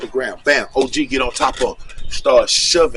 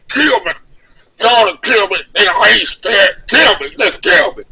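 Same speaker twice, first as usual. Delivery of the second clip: loud, high, desperate begging to be killed; desperate plea; 4 semitones higher, 4 dB louder than usual. Retold or imitated. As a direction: beg them to kill him, raw and loud. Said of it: "he said".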